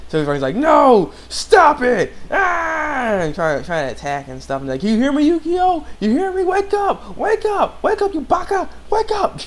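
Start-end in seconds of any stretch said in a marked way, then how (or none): 0.5-2.1 high, loud acted cry; mock desperate shout. Ren unable to just watch, mock frantic shout. 2.3-3.2 high, drawn-out mock scream; hammed-up attack cry; imitated battle yell. Ren trying to attack, mock scream. 4.8-9.4 very high put-on anime pleading; mock frantic calling. Ren calling to possessed Yukio, hammed-up frantic cries. Acts out frantic wake-up call.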